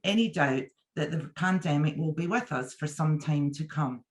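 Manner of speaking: usual speaking voice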